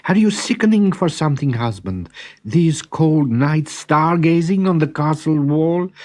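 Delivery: high, gentle worried voice; wife worrying about her husband; concerned wife. Acted. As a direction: fuss over him kindly.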